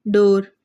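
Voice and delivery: door with o drawn out; door, o held for contrast; long o in door. Shown as a demonstration. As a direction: stretch the vowel of door. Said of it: "इन दोनों का difference करें".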